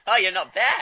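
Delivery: laughing out loud. Real, not acted. Host amused by the coach's accents, loud real laugh. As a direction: bursts out laughing mid-line.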